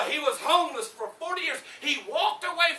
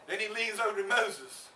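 usual speaking voice

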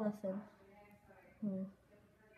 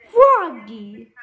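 loud call without raising the pitch; father's yell; 3 semitones lower, 18 dB louder than usual. Acted. Dad shouting at noisy Froggy, loud yell. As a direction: yell sternly for your child. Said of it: "yelled Dad".

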